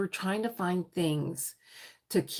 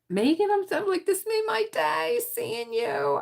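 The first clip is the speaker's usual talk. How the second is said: high-pitched overjoyed greeting; delighted squeal. Retold or imitated. Acted delight at seeing Megan back.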